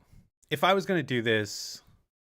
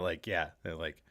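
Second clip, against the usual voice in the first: ordinary talk, nothing marked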